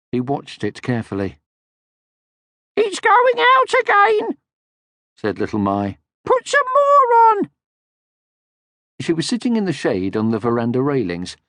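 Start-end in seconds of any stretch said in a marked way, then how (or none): 2.8-4.4 put-on very high, slow tiny girl voice; tiny cheeky creature voice; little Mai. Little Mai's voice. 6.2-7.5 put-on very high, slow tiny girl voice; tiny cheeky creature voice; little Mai. Little Mai's voice.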